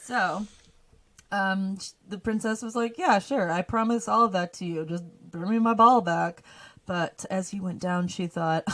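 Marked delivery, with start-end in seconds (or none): 3.0-6.4 loud, breezy promise; offhand loud agreement. Acted. Princess promising the frog anything for her ball, loud and offhand. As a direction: agree loudly to get it over with.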